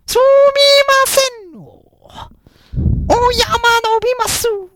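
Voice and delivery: very high, slow, drawn-out mock Japanese old woman; botched Japanese. Old woman answering the speaker, in high slow botched Japanese. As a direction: stretch each syllable high and slow like a frail old lady. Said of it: "I totally botched that Japanese".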